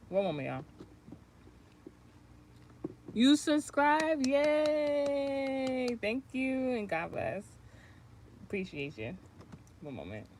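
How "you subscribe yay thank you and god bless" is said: high, slow, delighted; gushing over a new subscriber; excited thanks. Real, not acted. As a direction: squeal a thank-you to the viewer.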